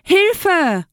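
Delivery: high squealed storybook cry; high-pitched little pig's yell; cry for help. A narrator's reading. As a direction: squeal it out like a panicked piglet. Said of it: "schrie das kleine Schweinchen".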